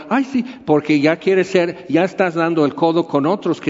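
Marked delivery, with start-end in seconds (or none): 0.1-0.4 "Ay, sí" agudo y afectado; hipocresía burlona. Retold or imitated. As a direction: remedo de falsa amabilidad. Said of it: "Hipócritas". (burla de la multitud hipócrita)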